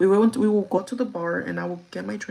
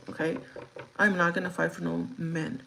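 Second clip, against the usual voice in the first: ordinary delivery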